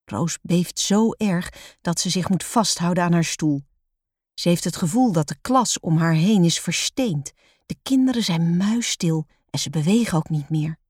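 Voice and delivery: plain reading voice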